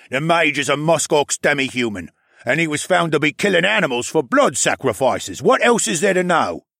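polar bear arguing. A narrator's reading. Polar bear accusing before the crowd, arguing. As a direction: build to a pointed rhetorical question.

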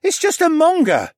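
put-on a sulky boy setting it straight; disgruntled boy. A narrator's reading. Stable boy correcting them, disgruntled and high. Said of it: "Ryland's disgruntled voice said".

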